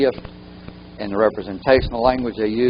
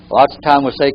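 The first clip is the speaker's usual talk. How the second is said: ordinary delivery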